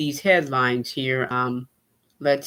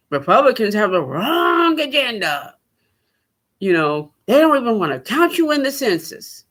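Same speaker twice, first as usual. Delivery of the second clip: mock campaign rant; 5 semitones higher than usual. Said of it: "that wasn't ranting and raving".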